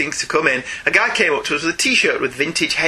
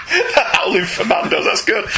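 repeating it through real laughter, higher; laughing. Real, not acted. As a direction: crack up while saying it. Cracks up at the pun.

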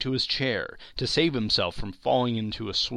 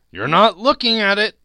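Scrooge insisting; 11 semitones higher, 12 dB louder than usual. Acted. Scrooge presses the ghost.

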